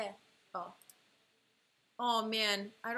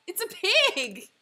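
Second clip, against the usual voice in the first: louder, slower, higher call; excited guess; 6 semitones higher, 7 dB louder, slower than usual. Real, not acted. Guessing the drawing.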